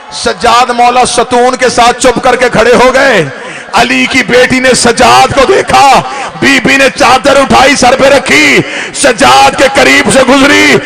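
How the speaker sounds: loud, high lamenting narration; keen the scene to the gathering; loud majlis lament; wailing preaching. Acted.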